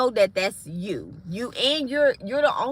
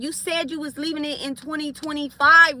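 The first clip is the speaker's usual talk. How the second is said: normal talking voice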